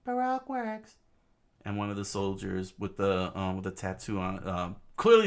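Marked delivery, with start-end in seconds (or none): 0.0-1.0 very high falsetto character voice; pitched far above the narration; story figure's voice. Acted.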